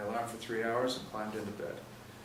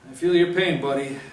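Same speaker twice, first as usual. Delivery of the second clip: louder, higher, mock sympathy to the cat; louder mock sympathy for a yowling cat; wry aside to a cat; 6 semitones higher, 11 dB louder than usual. A narrator's reading. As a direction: tell the cat you feel its pain, loud. Narrator talks to yowling cat.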